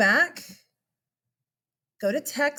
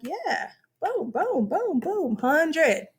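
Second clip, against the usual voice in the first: high, upbeat boom boom boom; excited quick grading; 6 semitones higher, much slower than usual. Retold or imitated. Teacher acting out grading instantly in a Hangout, gleeful. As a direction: rattle it off gleefully like stamping grades. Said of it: "And I'll be like".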